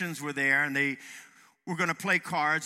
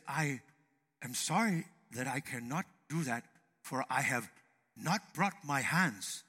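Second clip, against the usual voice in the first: soft, low, measured sage; wise Chinese man; 4 semitones lower, 5 dB quieter than usual. Acted. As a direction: speak softly and low, with pauses, as if wise. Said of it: "when Oriental people speak, it sounds so phenomenally wise".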